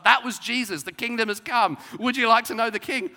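loud, high, blunt acted announcement; tactless proclamation. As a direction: blurt it out with no tact at all. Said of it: "she's not being tactful at all".

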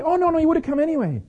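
put-on fast, high-pitched boyish protest. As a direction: rush out a defence in a boy's high voice. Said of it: "He came".

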